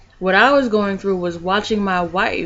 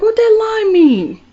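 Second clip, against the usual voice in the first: much higher, loud startled question; bewildered; 7 dB louder than usual. Retold or imitated. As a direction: demand it in shocked disbelief. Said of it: "And I'm like".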